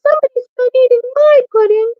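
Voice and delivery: put-on tiny, squeaky-high, quick Baby Bear; wee little voice. A narrator's reading. Baby Bear finds his pudding eaten, wee little voice. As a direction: pipe up fast and loud as a tiny bear. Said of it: "in his wee little voice".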